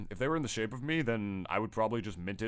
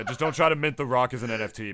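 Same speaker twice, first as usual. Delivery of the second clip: ordinary delivery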